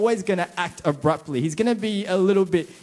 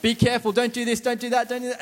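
fussing mum; 6 semitones higher, faster than usual. Retold or imitated. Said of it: "your mum before you leave".

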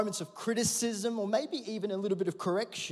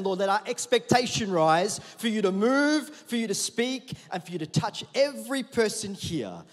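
louder, slowed, building prayer to the Lord; fervent prayer; 3 semitones higher, 8 dB louder than usual. Acted. Pastor praying over the congregation, fervent and loud. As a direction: lift the room in prayer, raising the voice.

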